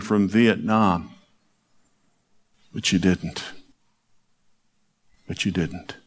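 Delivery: gentle recital of a poem; poem reading. Acted. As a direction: read it like a love letter to a lost husband. Reads a poem aloud.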